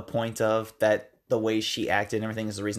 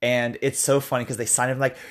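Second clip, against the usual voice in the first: usual speaking voice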